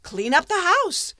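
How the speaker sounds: high, loud bossy order; commanding apprentice. Acted. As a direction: toss off the chore like a proud young master.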